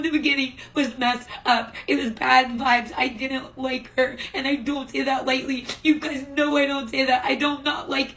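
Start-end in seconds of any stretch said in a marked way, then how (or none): none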